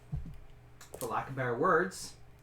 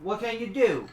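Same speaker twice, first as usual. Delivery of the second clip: high, loud, stubborn cook; 5 semitones higher, 4 dB louder than usual. Acted. The cook argues with the adventurer about the rooms, high and insistent.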